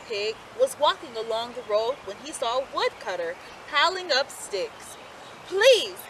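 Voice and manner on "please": higher, polite little pig; asking politely. A narrator's reading. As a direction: ask the woodcutter nicely. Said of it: "he asked politely".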